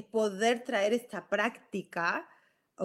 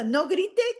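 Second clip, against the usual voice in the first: high retold parental shout; raised, higher-pitched mock scolding; mock yelling; 10 semitones higher, 4 dB louder than usual. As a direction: yell it quickly, as an example.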